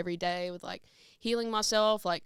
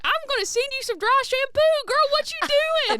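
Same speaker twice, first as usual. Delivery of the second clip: high, loud fussing mom voice, slowing at the end; mom impression; 8 dB louder than usual. Daughter imitating mom offering dry shampoo. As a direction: scold it like a fussing mother. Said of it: "She's like".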